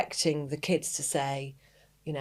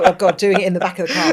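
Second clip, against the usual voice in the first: laughing; 2 semitones higher, 12 dB louder, faster than usual. Real, not acted. Laughing at shared memory.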